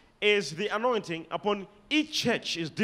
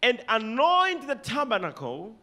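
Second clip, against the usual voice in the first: solemn scripture reading; 3 semitones higher than usual. Shown as a demonstration. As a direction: read out with lifted, ringing pitch. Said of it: "Underline the word tabernacle".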